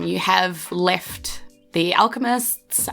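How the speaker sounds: ordinary delivery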